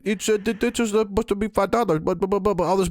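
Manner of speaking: high mock voice fumbling over a coupon; fussy old lady. Mocking the old lady explaining her double coupon, high fussy voice. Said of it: "Next lady in line, same crap".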